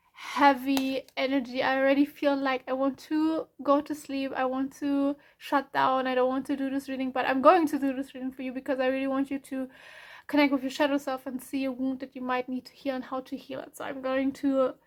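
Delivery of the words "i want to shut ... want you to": usual speaking voice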